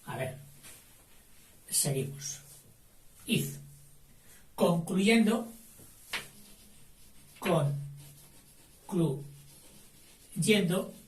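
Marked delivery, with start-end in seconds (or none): 4.6-10.9 dictation pace. Shown as a demonstration. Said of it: "dictation, drawn out".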